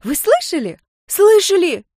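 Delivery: building to a shrill loud squeal; excited squeal. Acted. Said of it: "взвизгнула девчонка".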